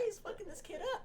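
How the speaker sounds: laughing high through the line; laughing at the beating. Real, not acted. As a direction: giggle it out in disbelief. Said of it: "laughing at replay".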